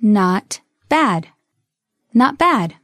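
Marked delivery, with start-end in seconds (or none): 0.0-1.3 slow, separated words; slow model. Shown as a demonstration. Teacher modelling a phrase for learners, slowly. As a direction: set the two words apart.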